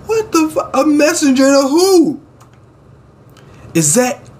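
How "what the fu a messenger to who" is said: high-pitched, baffled reaction; incredulous outburst. Real, not acted. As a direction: blurt it out high, in utter disbelief. Reacting in disbelief to the story.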